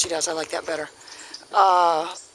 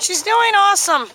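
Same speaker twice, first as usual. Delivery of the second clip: excited; 12 semitones higher, 6 dB louder than usual. Real, not acted. Pleased with the dog.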